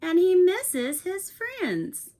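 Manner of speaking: animated picture book read-aloud; reading to children. A narrator's reading. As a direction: read it like bedtime story time for a class. Teacher reads picture book aloud.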